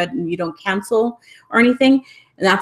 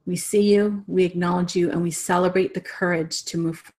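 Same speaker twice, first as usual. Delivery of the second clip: guided meditation blessing. Real, not acted. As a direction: guide them gently into a closing blessing, breathing between phrases. Leads closing meditation and blessing.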